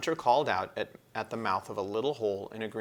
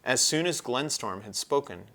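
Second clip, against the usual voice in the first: usual speaking voice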